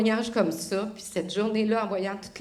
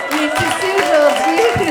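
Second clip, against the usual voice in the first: much louder and higher, soaring at the end; jubilant shout; 9 semitones higher, 15 dB louder than usual. Real, not acted. Repeating to the congregation that he is here, shouting with joy. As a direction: shout it out in triumph.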